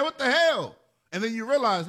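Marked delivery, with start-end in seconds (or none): 0.0-0.8 angry grandparent. Retold or imitated. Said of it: "You're saying".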